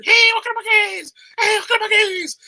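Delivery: very high, loud sing-song welcome; cheery greeter. Retold or imitated. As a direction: belt the greeting like an overexcited store clerk. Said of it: "everyone's like".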